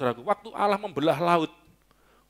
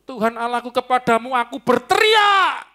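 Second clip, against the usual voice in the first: high shouted prayer building louder; crying out to God; 7 semitones higher, 6 dB louder than usual. Shown as a demonstration. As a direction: build to a long, ringing shout. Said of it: "Memangnya kenapa saudara berteriak?".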